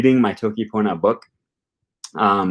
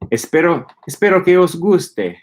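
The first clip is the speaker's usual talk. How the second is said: higher-pitched Castilian Spanish with an Italian-sounding lilt; Castilian Spanish, almost Italian in flavor; Castilian accent; 8 semitones higher, 4 dB louder than usual. Shown as a demonstration. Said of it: "Italian sounding flavor almost".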